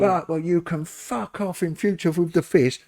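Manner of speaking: a retold huff from the offended fishmonger; mock angry retort. Fishmonger angrily throws salmon.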